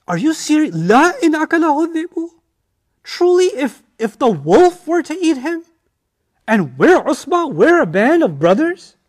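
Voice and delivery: high, loud, indignant protest; offended brothers' protest. Acted. Brothers protesting to their father.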